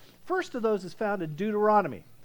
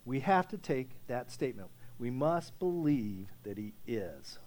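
earnest and quiet; 8 semitones lower, 6 dB quieter than usual. Real, not acted.

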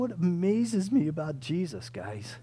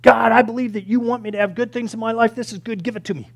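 loud, higher mock demand; 5 semitones higher, 8 dB louder, faster than usual. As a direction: insist as if entitled to it. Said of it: "sometimes we just demand it".

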